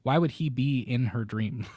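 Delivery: ordinary delivery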